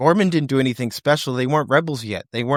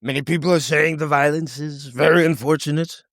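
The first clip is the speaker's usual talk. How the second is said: mock emperor boss; 3 semitones higher than usual. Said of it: "he can go".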